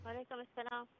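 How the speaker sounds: thin phone line voice; over the phone. Real, not acted. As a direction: heard through a phone speaker. Doctor's voice heard via phone.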